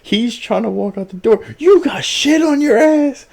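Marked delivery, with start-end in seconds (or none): 1.6-3.2 high-pitched mock outraged yell; retold screaming. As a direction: imitate yelling in disgusted disbelief. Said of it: "Starts screaming even more".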